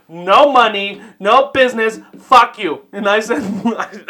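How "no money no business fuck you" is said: loud, emphatic imitation of an angry caller; mock screaming. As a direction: lay down the law in a mock yell. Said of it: "like, screaming at me".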